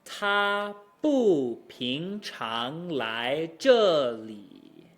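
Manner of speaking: very slow, swooping tones on "bu ping chang lai"; drawn-out syllables falling away on "zhe li"; exaggerated teacher's demo; model Mandarin tones. Shown as a demonstration. Teacher models the example sentence.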